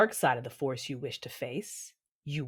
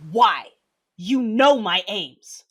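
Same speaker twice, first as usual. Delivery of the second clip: loud, raised, weighty demand; angry, slow and forceful; mother's anger; 7 semitones higher, 11 dB louder, much slower than usual. Acted. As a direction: confront her with a mother's anger. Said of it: "the anger of a mother giving weight to my words".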